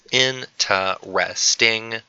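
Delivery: "interesting" in four separate beats; split into syllables. Shown as a demonstration. Teacher proving the count to students, "interesting" split in four. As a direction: break "interesting" apart as in, te, res, ting. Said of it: "four syllables".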